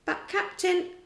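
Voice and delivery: a surprised pirate objecting; protesting pirate. A narrator's reading.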